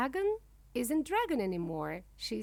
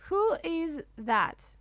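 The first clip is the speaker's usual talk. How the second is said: higher, louder, drawn-out storybook cry; startled cry; 6 semitones higher, 5 dB louder, slower than usual. A narrator's reading. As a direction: cry out slowly in mock alarm. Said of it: "looks at the bird and cries".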